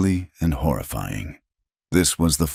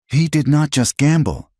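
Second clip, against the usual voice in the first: high, strained accusing voice; wounded widow unloading years of pain; Clara, desperate; 11 semitones higher, 4 dB louder than usual. A narrator's reading. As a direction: pour out the hurt, pitched high. Clara's confession.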